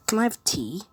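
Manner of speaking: British accent. Shown as a demonstration. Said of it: "a British accent. That could work".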